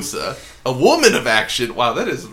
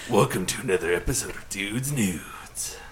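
whispered sultry intro; breathy seductive host whisper; sultry narrator; 6 semitones lower, 6 dB quieter than usual. Pretend. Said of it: "give us a sultry voice".

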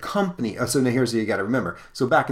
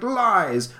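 much higher outraged cry; indignant miser; 11 semitones higher, 4 dB louder than usual. Acted. Old miser denies his partner's story, high outraged cry. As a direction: shout down the accusation in a high squawk. Scrouge denies Farley's tale.

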